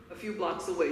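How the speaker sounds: ordinary talk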